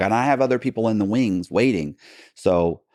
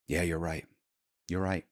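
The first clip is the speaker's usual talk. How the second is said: quiet agreement; 10 dB quieter than usual. Retold or imitated. Eric quietly concedes.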